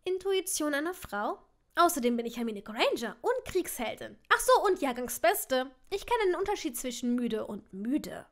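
animated, cheeky self-praise; playful boasting. Acted. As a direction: reel off your merits with proud emphasis. Woman playfully boasts of her merits.

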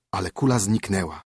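usual speaking voice

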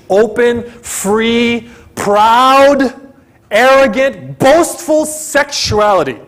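climbing, emphatic list; rising preacher intensity. Real, not acted. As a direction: build the list to a proud shout. Preacher building on sinful pride.

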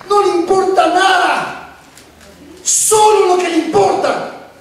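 high, ringing preacher's cry; impassioned preaching. Real, not acted. Preacher telling the congregation what matters to her, impassioned. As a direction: drive each phrase home like a preacher.

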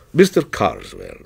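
low, hushed-in-awe name; deep, solemn reverence; reverent. A narrator's reading. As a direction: say the name like a benediction. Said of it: "as reverently as if the name".

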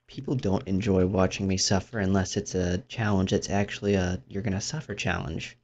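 normal talking voice

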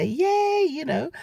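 high, loud cheer; a happy squeal of welcome; excited yay. Retold or imitated. Greeting her sister with a loud happy yay.

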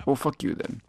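normal talking voice

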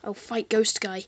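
ordinary delivery

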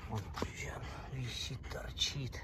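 soft whisper to the dog. Real, not acted. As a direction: whisper it tenderly. Cooing over dog's belly.

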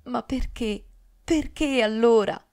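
sudden outburst, rising loud on the second question; bursting out. A narrator's reading. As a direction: burst out in anguish. (sfogo improvviso e disperato)

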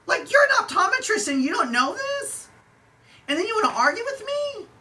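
can you believe this guy, voice shooting high; incredulous disbelief. Real, not acted. Exasperated rant about the optometrist.

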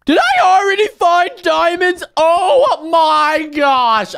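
high, loud gasping disbelief; excited shout. Real, not acted. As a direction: shriek with joy at the find. Finds diamonds in the cave.

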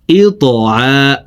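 slow "এ ত আ" with the "আ" held long; drawn-out long vowel. Shown as a demonstration. Teacher showing how to lengthen ayn, the final "আ" held long. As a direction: stretch the final "আ" for one alif's length. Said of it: "এক আলিফ টেনে পড়ব".

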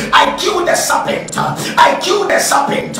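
ordinary delivery

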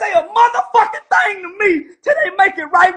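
real anger at those who owe her an apology; heated shout. Real, not acted. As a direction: shout the demand with emotion. Ranting on another's behalf.

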